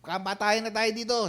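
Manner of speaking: high, indignant mother's outburst; mother's furious challenge, pitched high; angry mother. Retold or imitated. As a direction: voice the mother raging at whoever hurt her child. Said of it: "galit na galit siya".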